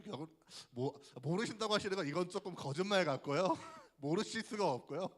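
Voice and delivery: normal talking voice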